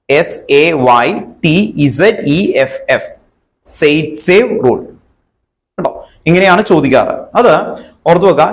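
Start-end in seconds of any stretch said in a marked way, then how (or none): 0.1-4.8 slow, letter by letter; spelled out. Shown as a demonstration. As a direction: spell it out slowly for dictation. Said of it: "spelling ഇങ്ങനെ എഴുതണം". (പതുക്കെ അക്ഷരം അക്ഷരമായി)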